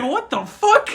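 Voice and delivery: mom's shock. Retold or imitated. Mom reacting.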